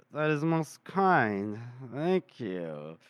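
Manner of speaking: slow, measured formal thanks; stiff courtesy. Acted. Gorst answering Felnik's praise, slow and stiffly polite. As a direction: thank them slowly, sinking low at the end.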